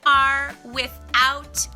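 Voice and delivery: ordinary delivery, nothing marked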